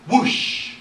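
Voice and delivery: forceful, airy whoosh effect; loud whoosh. A narrator's reading. Narrator imitating a sudden noise, loud whoosh. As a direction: burst it out as a sound effect.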